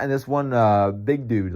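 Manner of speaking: ordinary delivery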